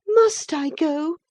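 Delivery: put-on slow, high girlish plea; doleful question. A narrator's reading. Catherine bends over Linton, slow doleful plea. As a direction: ask slowly, high and woeful. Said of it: "asked Catherine dolefully".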